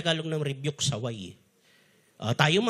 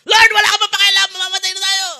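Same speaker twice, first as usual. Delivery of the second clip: high, loud desperate cry to Jesus; panicked shout; 13 semitones higher, 9 dB louder, faster than usual. Retold or imitated. Disciples shouting to Jesus in the storm, loud and high. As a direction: yell it as if the boat is sinking. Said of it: "Kakausapin mo si Jesus ng ganyan?".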